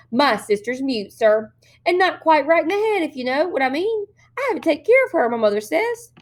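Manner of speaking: put-on high innocent little girl explaining; little girl covering for her sister with the police; sweet childish voice. A narrator's reading. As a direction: play the dutiful child. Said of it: "little girl voice sweet and childish".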